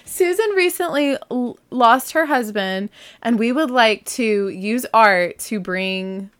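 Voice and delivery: welling up over the widow's postcard; choked up. Real, not acted. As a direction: voice catching as she fights back tears. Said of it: "I think I might start crying while I'm telling this".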